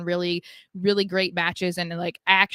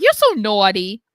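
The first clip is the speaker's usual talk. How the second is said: louder teasing Sal impression; imitating Sal; 8 dB louder than usual. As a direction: lean on "naughty" like a creepy tease. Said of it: "he keeps being like".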